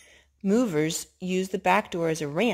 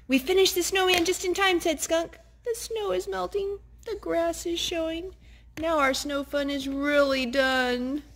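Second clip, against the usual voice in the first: much higher, small squeaky Skunk voice; 11 semitones higher than usual. Acted. Parent reading, voicing Skunk for the kids: high and squeaky. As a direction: pitch up high for the little skunk.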